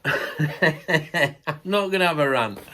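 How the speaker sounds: slow, laughing it out. Real, not acted. Vlogger laughing at himself about ranting. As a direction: laugh through the promise, slowly.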